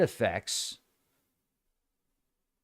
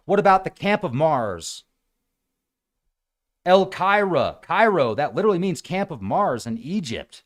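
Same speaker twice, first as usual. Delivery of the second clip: ordinary talk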